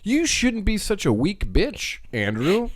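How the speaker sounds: mock his own weakness slowly; husband teasing himself for the wife; mock scolding. Mocking himself as brother.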